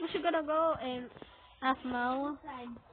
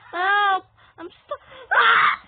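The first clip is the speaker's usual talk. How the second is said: high, loud cry for help; panicked shout; 5 semitones higher, 10 dB louder than usual. Real, not acted. Player stuck under attack, panicked shout. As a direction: shout in panic, breaking into a scream.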